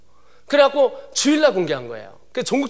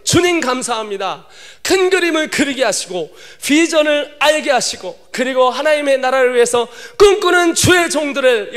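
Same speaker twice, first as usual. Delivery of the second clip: earnest, slightly raised prayer voice; closing prayer; 4 semitones higher, 5 dB louder than usual. Acted. A pastor praying for the youth, earnestly. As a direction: pray over the young people.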